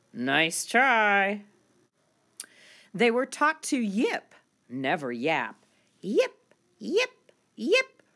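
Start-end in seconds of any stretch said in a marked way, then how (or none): 6.0-8.0 very high little puppy yips. Acted. Little poodle pups practising polite yips, very high. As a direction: yip like a tiny poodle pup. Puppies taught to yip.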